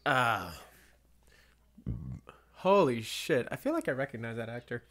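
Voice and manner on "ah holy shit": ordinary delivery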